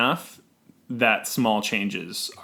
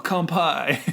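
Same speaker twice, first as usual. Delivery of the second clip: lighter surfer toast; 4 semitones higher than usual. Pretend. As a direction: toast it like a beach dude. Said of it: "I don't like your surfer voice".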